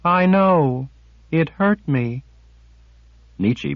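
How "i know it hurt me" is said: fearful. A narrator's reading. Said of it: "into a fearful cast".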